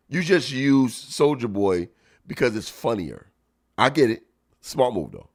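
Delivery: ordinary talk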